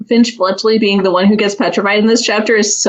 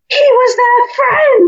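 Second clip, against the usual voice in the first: high, slow, crying shout; anguished shout; 3 dB louder, slower than usual. Retold or imitated. Harry screaming in Hogsmeade snow, crying shout. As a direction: wail it out in outrage. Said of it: "he's crying".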